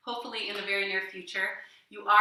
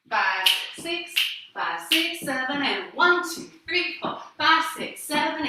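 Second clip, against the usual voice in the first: loud called-out counts, higher; 5 semitones higher, 5 dB louder, slower than usual. Shown as a demonstration. Teacher counting the class through the step. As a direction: call the count like a dance teacher. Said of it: "Let's try just that".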